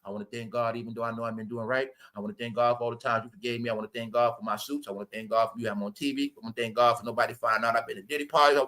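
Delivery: low, soft, fast mock prayer of thanks; quietly hammed-up Steve Harvey gratitude speech. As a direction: mumble a hurried imitation of Steve Harvey thanking God. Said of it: "He said God so much".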